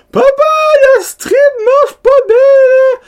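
put-on high, whiny little-kid sob; crying child. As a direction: whimper it like a sleepy son whose nose is blocked. Son calls out at night.

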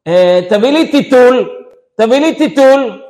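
high, loud mock order; imitated shouted demand; wife demanding. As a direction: yell for it across the house as the wife. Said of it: "היא אומרת לו".

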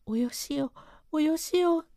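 breathless, strained pleading as she writhes; gasping stop it while struggling; struggling plea. A narrator's reading. Maid pinned down by the clerk, strained pleading. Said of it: "体をもがくので".

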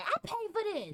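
high, smug parent voice. Retold or imitated. Son mimics parent bragging about paying, in a high mocking voice. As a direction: parody the parent who claims everything. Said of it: "they be like".

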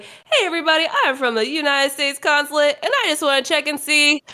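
high, beaming official voice with a laugh; overly cheerful consulate greeter calling names; too happy. Retold or imitated. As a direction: sound delighted to be there. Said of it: "he was so happy".

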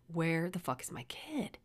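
slow, hushed, whispery freak-out; contained alarm, voiced like a thought screamed silently; internal panic. Parent who can't find the kid, screaming internally under the breath. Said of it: "I'm screaming".

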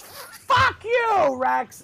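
high yelled curse at his brother; kid's angry shout. Retold or imitated. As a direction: scream it like an enraged five-year-old. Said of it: "Odie just goes".

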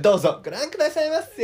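shop greeting call. Retold or imitated. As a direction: sing it out to customers. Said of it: "ひたすらやってたの".

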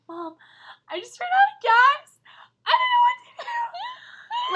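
high wailing mock sob to mom; hammed-up sobbing into the phone; retold bawling. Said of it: "So I'm bawling my eyes out".

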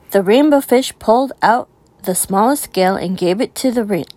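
usual speaking voice

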